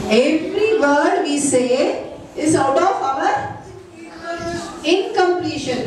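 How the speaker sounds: slowly, weighing each word, so it sinks in; slow, deliberate repeat. Shown as a demonstration. Teacher repeats the line slowly for students to absorb, slow and deliberate. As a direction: spell it out at a slow teaching pace. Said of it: "teacher repeats it slower".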